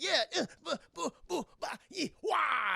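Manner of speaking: high, staccato mock martial-arts cries; little yelps building to a final "wah"; imitated fight noises. Two partners doing cute bro-bro moves, mock fight cries.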